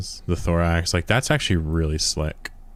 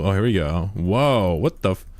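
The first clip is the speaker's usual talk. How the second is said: usual speaking voice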